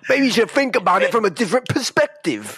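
high-pitched hammy Cockney advice; wise old Cockney sweep. Pretend. Helpful sweep advising Agent Starling, high Cockney voice. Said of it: "like, the wise old chimney sweep".

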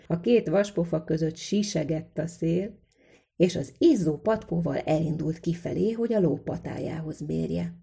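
ordinary delivery

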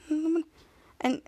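ordinary talk, nothing marked

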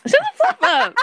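high, loud mock outrage; playful shout. Real, not acted. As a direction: shout it out, laughing indignation. Teasing outburst among friends.

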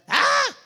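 high sung "Ah!" belted out loud; a sudden high singing note; sung high cry. Shown as a demonstration. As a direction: belt a high sustained note. Demonstrating singing frequency.

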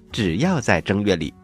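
usual speaking voice

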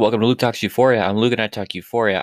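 loud, unsteady intro greeting; shaky voice. Real, not acted. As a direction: shout the intro with a wobble. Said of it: "I kinda sound like I have a shaky voice right now".

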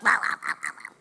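very low mock growling "rah rah"; angry grumbling. Three grandmothers fuming in the corner, mock angry grumbling. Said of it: "we were so angry".

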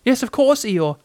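high, small character voice; high-pitched, eager reply; little Piglet, reassuring. A narrator's reading.

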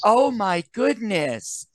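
high, slow, prim shock; mock scandalized gasp. Host mocking prudish theater-goers, slow and high. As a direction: clutch the pearls in slow horror. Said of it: "Nobody looked at him and went".

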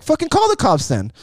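very high-pitched mock defiance; imitating an indignant challenge; retold angry dare. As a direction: act out someone calling a bluff. Acted quarrel, man dares her.

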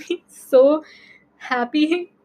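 usual speaking voice

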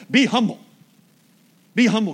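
loud repeated command; mock preacher command. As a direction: bark it like a nagging preacher. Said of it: "I can't just stand up here and go".

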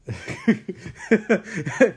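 high, loud burst of laughter; real loud laugh. Real, not acted. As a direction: crack up out loud. Laughing at own joke.

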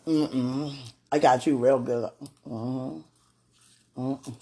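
ordinary talk

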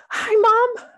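high, loud burst of excitement; excited kid. Retold or imitated. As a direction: greet her like a kid who can't wait. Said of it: "He was so excited".

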